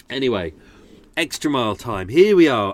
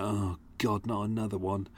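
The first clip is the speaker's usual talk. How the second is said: weary dismay; 3 semitones lower, 8 dB quieter than usual. Retold or imitated. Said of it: "And I was like".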